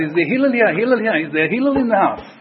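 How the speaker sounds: loud, brash calling out for Hillel; cheeky call. Retold or imitated. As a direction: yell rudely through the house. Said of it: "he was speaking very chutzpahdik".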